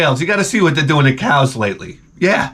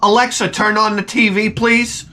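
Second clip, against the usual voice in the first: high-pitched call to a voice assistant; mock calling out; 7 semitones higher than usual. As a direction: imitate kids calling to Alexa. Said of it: "hear you all shouting".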